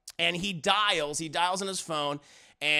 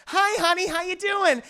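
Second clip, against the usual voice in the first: high-pitched, louder put-on greeting; mock-sweet wife; 10 semitones higher, 4 dB louder than usual. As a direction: play the wife covering with cheery sweetness. Wife greets husband, hiding lover.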